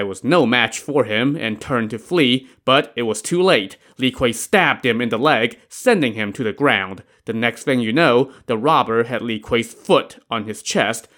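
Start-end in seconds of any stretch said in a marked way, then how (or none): none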